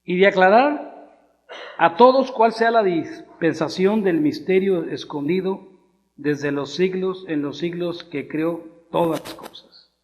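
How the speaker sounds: loud, slow, declaimed verse; scripture reading. Acted. Preacher reads Ephesians to the congregation, loud scripture-reading voice. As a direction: proclaim the verse with drawn-out, weighty phrasing.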